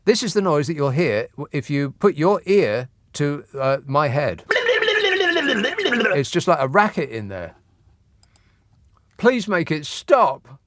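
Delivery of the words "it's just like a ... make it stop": mock pleading. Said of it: "racket in my head, begging".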